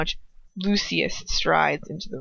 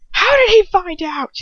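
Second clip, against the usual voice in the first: higher, louder hiss through gritted teeth; 10 semitones higher, 8 dB louder than usual. A narrator's reading. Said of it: "his voice hissing through his gritted teeth".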